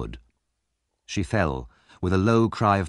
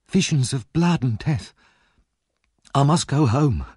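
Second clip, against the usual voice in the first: raised, trembling refusal; fearful young woman; 5 semitones higher than usual. A narrator's reading. Nancy refusing rescue out of dread, fearful. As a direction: torn and frightened, she must go.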